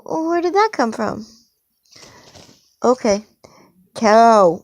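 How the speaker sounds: ordinary talk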